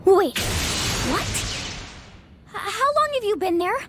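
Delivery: flustered alarm; startled. Acted. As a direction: caught off guard, rattled. Startled by stranger present.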